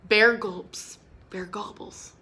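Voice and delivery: plain reading voice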